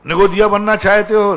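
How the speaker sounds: high, loud rhetorical challenge; challenging question. Real, not acted. Preacher loudly questioning the congregation.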